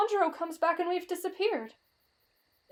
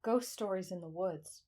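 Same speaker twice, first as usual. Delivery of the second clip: low, soft chapter heading; title announcement; 9 semitones lower, 10 dB quieter, slower than usual. A narrator's reading. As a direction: announce the title quietly and slowly, low in pitch.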